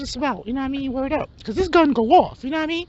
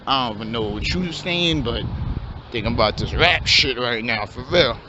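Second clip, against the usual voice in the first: low, laid-back street voice of a hopeful rapper; wannabe rapper. Acted. Aspiring rapper pitches his rap plan to his friend, low and laid-back.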